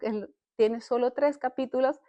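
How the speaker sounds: usual speaking voice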